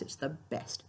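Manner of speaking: breathy whisper, very soft. Real, not acted. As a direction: whisper it fondly. Fond aside, unvoiced.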